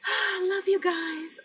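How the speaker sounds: high, slow, breaking love you; tearful. Real, not acted. Saying goodbye to her viewers, tearful and slow. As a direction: choke it out through tears.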